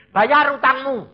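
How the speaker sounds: high, loud divine order; commanding. Acted. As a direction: deliver a forceful command. Voices God's command to him. (perintah tegas dengan suara lantang)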